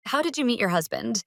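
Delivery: ordinary talk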